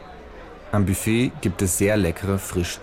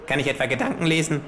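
kangaroo voice; 8 semitones higher, faster than usual. Acted. Kangaroo fanning itself with play money, smug higher voice. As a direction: deliver it like a smug know-it-all.

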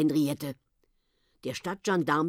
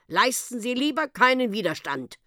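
raised, higher-pitched warning; calling out; 7 semitones higher than usual. A narrator's reading. Gendarme handcuffing people, calling out. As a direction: call it out as an order. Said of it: "und rief".

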